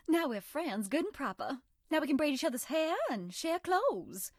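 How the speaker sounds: put-on a coy Southern girl sweet-talking a new friend; Southern belle character. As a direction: play the sweet, teasing Southern charmer. Voiced game character Bonnibel lines.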